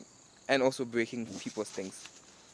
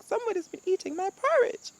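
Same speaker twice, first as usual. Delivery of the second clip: high squeaky mother bear voice, louder; Mama Bear; 5 dB louder than usual. Acted. Mama bear squeals that someone ate her porridge. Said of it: "They're like".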